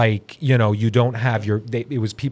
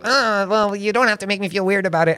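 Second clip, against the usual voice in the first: imitating a whiny Ben; 5 semitones higher than usual. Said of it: "And you'll be like".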